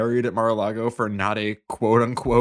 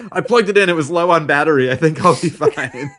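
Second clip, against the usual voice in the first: laughing mock voice; 5 semitones higher, 7 dB louder than usual. Joking as the electrocuted man.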